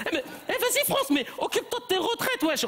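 put-on high, laughing street-kid taunt; mock a classmate like a cheeky teen; cheeky schoolkid. Pupil mocking France, banlieue slang.

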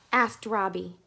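usual speaking voice

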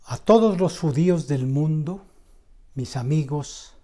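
loud, slow declamation with long pauses between phrases; impassioned poem recital. Acted. Reciting a poem on Auschwitz to channel viewers, impassioned declamation.

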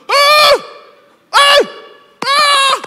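very high loud shouts across the store; loud calling shout. Retold or imitated. Mute man shouts from the aisle end, very loud high calls. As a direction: yell to catch their attention.

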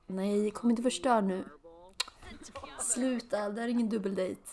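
usual speaking voice

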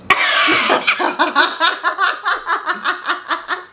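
high, wheezing laugh. Real, not acted. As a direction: burst out laughing. Cracking up at the story.

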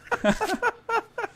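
high, breathy laugh of relief; relieved laugh. Real, not acted. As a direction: laugh out the tension. Relief after being spared.

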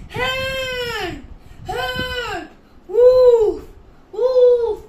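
loud, high, drawn-out cries; shouting for help. Acted. Boy sees a real wolf hunting his sheep, shouting. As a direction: scream out in alarm for rescue. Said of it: "he shouted".

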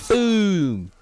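loud excited boom; shout. Real, not acted. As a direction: yell it out. Hype shout during game.

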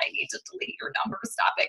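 whispered, fast and desperate; exasperated whisper. Pretend. Fed up with a pushy seller, whispering. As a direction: hiss it under your breath to the pushy seller. I'm just like.

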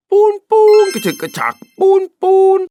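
high, loud choo-choo train sounds; toy train noises. Pretend. Boy shows the toy train from his mother, with loud playful train noises. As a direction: chug like a toy train, with a child's delight.

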